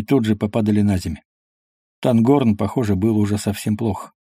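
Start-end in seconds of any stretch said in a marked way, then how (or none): none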